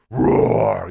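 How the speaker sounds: big, loud roar; lion roar. Shown as a demonstration. Coach showing kids their big lion roar. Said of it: "Their lion voice is a roar".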